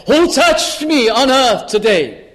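slow, high, drawn-out call; God's voice. Acted. Preacher voicing God, slow and lifted. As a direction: voice God calling down from heaven.

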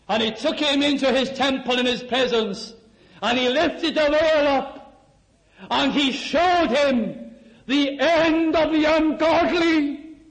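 ringing, climactic preacher's storytelling; full-force preaching. Acted. Preacher telling how God lifted the veil for David, mounting proclamation.

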